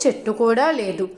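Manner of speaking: plain reading voice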